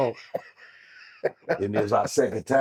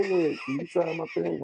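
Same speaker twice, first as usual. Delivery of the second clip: eager fan; 10 semitones higher than usual. Retold or imitated.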